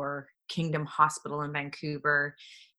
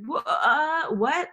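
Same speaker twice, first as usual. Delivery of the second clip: stunned; 10 semitones higher, 4 dB louder, much slower than usual. Retold or imitated. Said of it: "I was like".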